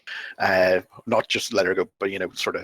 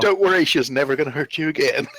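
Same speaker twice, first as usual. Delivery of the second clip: higher, quick in-character reassurance; acted reassurance; 7 semitones higher, 4 dB louder, faster than usual. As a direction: blurt out a reassurance, pitched up and fast. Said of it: "I'm gonna be like".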